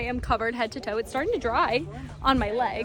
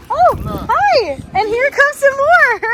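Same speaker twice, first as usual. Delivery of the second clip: delighted squeal; 12 dB louder, slower than usual. Real, not acted. Village kids running up.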